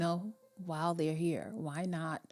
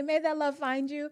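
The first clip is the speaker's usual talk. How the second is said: mock Nigerian blessing; 10 semitones higher, 8 dB louder than usual. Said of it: "that whole thing where they say".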